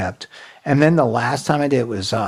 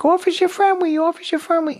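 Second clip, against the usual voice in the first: squeaky-high, childlike put-on voice. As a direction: play helpless and impaired for the police. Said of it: "I pretend like the left side".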